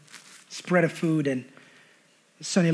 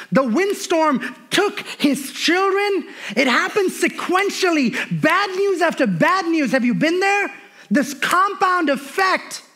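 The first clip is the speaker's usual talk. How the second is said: slow, high, loud sermon delivery; building pulpit intensity, drawing words out; full-force preaching; 8 semitones higher, 5 dB louder than usual. Acted. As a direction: preach each blow of Job's story to the crowd. Preaching the Job story.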